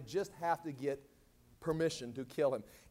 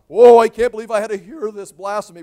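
very loud, higher mock horror; playing outraged; 4 semitones higher, 21 dB louder than usual. High priest going on about blasphemy, playing outraged. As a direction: put on shock for the crowd. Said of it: "he's just playing out the role".

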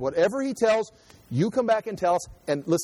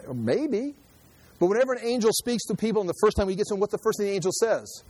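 ordinary talk, nothing marked

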